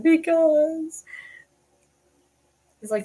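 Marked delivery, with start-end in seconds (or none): 0.0-1.0 whiny because. Retold or imitated. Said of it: "I'm like".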